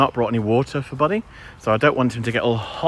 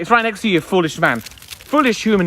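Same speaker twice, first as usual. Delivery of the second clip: high put-on voice for the dog; dog's voice; 9 semitones higher, 3 dB louder than usual. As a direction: voice the dog mocking its owner. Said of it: "He's like".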